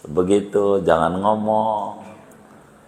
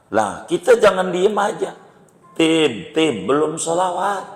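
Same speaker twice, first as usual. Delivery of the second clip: higher, calling up to the preacher; mock reminder; 7 semitones higher than usual. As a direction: nudge the khatib he forgot. Menegur khotib yang lupa. (menegur khotib dengan suara tinggi)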